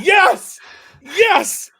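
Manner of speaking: high, loud thrilled yes; excited. Retold or imitated. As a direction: squeal it out with glee. Thrilled reply to lifeguard.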